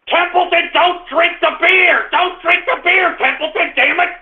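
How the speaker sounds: owner yelling at his dog to leave the beer alone; shouting at the dog. Real, not acted.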